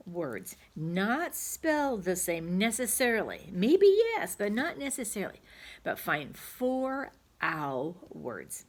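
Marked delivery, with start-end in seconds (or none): 3.5-5.4 much higher and louder, playful; high emphatic aside. Shown as a demonstration. 5.8-8.6 slowly, stretching find four ow words; slow emphatic instruction. Shown as a demonstration. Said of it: "teacher repeats instruction slowly".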